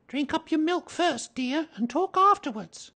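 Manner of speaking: high, fussing mother voice; motherly. A narrator's reading. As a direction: remind a little one in a high maternal voice. Mother Kanga instructs little Roo.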